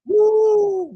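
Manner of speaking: high, loud whooping cheer; a real, delighted woo; excited cheer. Real, not acted. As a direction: whoop for the shot. Cheering a made shot.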